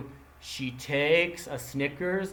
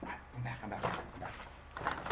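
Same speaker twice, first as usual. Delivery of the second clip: far off; 4 semitones lower, 14 dB quieter than usual. Real, not acted. Teacher returning off mic.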